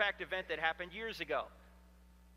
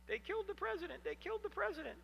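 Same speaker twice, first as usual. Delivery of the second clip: weeping; 12 semitones higher, 6 dB quieter than usual. Retold or imitated. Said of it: "weeping uncontrollably".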